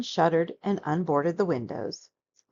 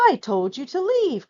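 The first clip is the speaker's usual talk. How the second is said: cried out; 7 semitones higher, 4 dB louder than usual. A narrator's reading. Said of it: "cried Bear".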